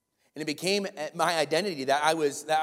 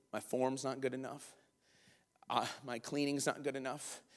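soft, brooding inner voice; subdued self-critical musing; retold self-doubt; 9 dB quieter than usual. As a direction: think it quietly to yourself, discouraged.

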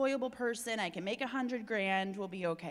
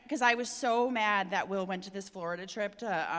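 ordinary talk, nothing marked

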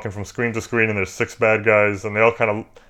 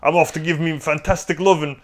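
exaggerated fake cockney gangster accent, pitched up; over-the-top cockney accent; 7 semitones higher than usual. Podcast host quoting the movie gangster in a fake cockney accent. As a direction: ham up a never-been-to-England cockney accent. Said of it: "accent I'm doing there".

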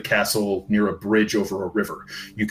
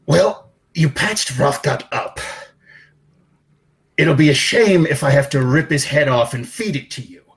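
higher-pitched, sneering giant boss voice; menacing giant boss; 4 semitones higher than usual. Acted. Ogre boss berating a henchman, sneering threats. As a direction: threaten the underling with contempt. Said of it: "another voice, speaking giant as well, says".

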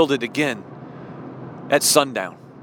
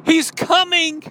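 higher and louder, calling her friend awake; urgent call; 12 semitones higher, 5 dB louder than usual. Acted. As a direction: wake Martha with an urgent cry. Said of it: "she calls her friend and she says".

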